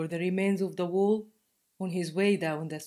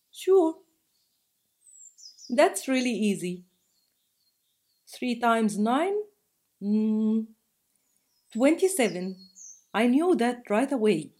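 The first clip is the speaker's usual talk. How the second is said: lightly voiced young girl, eager and pleased with herself; a hint of a child showing off her sums; proud little girl; 5 semitones higher, 6 dB louder than usual. A narrator's reading. Girl boasting to the stranger, lightly voiced proud child. Child Layla answers the math question.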